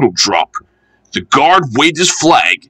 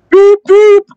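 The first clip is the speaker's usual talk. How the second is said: high piercing engine whistle beeps; 8 dB louder than usual. Acted. Edward calling his late passengers with a high whistle. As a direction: beep like a steam engine whistle.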